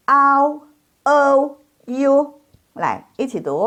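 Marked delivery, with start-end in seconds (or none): 0.1-2.1 Au, O, U as ao, ou, iu, high and level; model diphthongs. Shown as a demonstration. Teacher models the third group, high sustained glides. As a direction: draw out each glide on a high pitch. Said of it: "Jadi bacanya".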